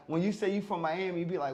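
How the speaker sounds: usual speaking voice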